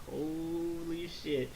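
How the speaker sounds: slow, drawn-out soft exclamation; awed. Retold or imitated. Viewer reacting to Vegeta's entrance, slow hushed awe. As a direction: stretch it out in mock awe.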